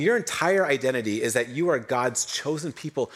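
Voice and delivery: normal talking voice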